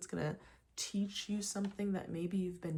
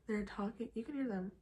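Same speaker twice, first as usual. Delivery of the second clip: usual speaking voice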